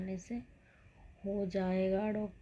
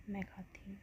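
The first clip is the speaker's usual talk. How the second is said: confiding whisper; 10 dB quieter than usual. Real, not acted. Admitting she eats it.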